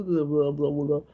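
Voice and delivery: put-on low, barely opened mouth New Zealand accent; mock New Zealand mumble. Making fun of how New Zealanders talk now, lazy New Zealand mumble. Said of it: "can't be bothered opening their mouth properly".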